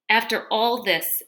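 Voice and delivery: ordinary delivery, nothing marked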